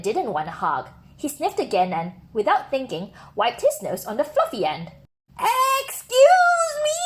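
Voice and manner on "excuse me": high, slow indignant shout; outraged rabbit yelling. Acted. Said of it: "shouted the rabbit".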